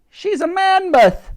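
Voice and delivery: high, loud shouted correction; Dottie. A narrator's reading. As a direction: call it back, stretching the words. Dottie calls back from the mammoth.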